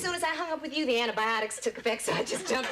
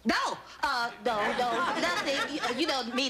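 ordinary talk

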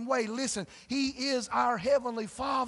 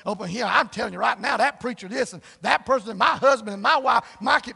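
complaining prayer; 8 dB louder than usual. Pretend. Mock churchgoer ranting at God in the prayer room. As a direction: rail at God like a grumbler. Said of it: "Don't run up in the prayer room".

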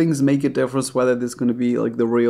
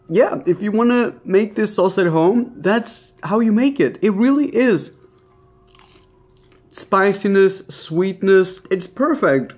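excited; 6 semitones higher than usual. Real, not acted. Tasting the copycat sauce, thrilled.

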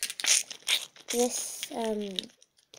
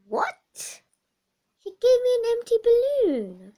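high, loud cry of betrayal; outraged disbelief; 10 semitones higher, 8 dB louder than usual. Acted. Scammed buyer discovering the empty balloon, outraged and loud. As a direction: shout it, stunned at being scammed.

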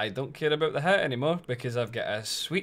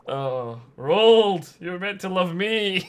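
louder, higher, hammed-up lament; mock heartbreak; 6 semitones higher, 8 dB louder, slower than usual. Streamer lamenting her villager's betrayal, mock heartbroken. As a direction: wail at the lost love.

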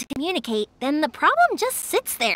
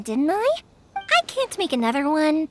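ordinary talk, nothing marked

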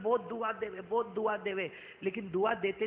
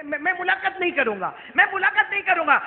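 high, loud preaching that climbs to a shout; impassioned sermon delivery; full-force preaching; 10 semitones higher, 11 dB louder than usual. Acted. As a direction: preach it out to the crowd, rising to a cry. Prophet's promise, preached.

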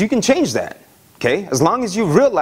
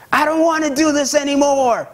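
put-on high, whining child; whiny kid; 9 semitones higher than usual. Hurt child giving up on the bike, high and whiny. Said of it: "and they're like".

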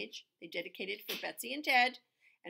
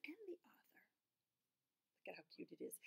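whisper; 4 semitones higher, 22 dB quieter, much faster than usual. Real, not acted. Whispered aside.

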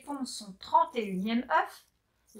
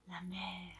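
awed whisper; 4 semitones lower, 17 dB quieter than usual. A narrator's reading. Whispered awe at first sight.

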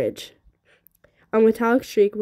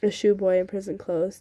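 plain reading voice

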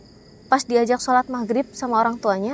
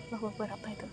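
scared hushed exclamation; 3 semitones lower, 20 dB quieter, much faster than usual. Real, not acted. Startled by a noise.

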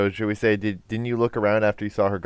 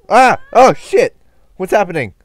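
startled yelp; 11 semitones higher, 13 dB louder, slower than usual. Real, not acted. Player's character suddenly gets captured.

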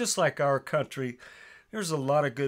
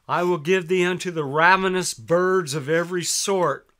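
raised, louder preaching read of the Bible; scripture reading; 3 semitones higher, 6 dB louder than usual. Acted. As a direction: proclaim the verses like a preacher.

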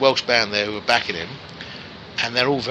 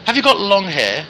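put-on "Have you got long hair?" in a higher, louder Welsh accent; 8 semitones higher, 5 dB louder than usual. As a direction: Welsh accent, pushed up high. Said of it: "the Welsh accent".

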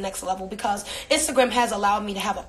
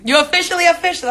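higher, louder and fast hype; excited; 7 semitones higher, 6 dB louder, faster than usual. Real, not acted. Congratulating a new app member, excited, higher and louder. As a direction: cheer the viewer on quickly.

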